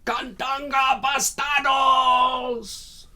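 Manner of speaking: long, high, drawn-out battle taunt; top-of-the-voice holler. Acted. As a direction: holler it across the countryside, stretching each word. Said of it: "he hollers at the top of his voice".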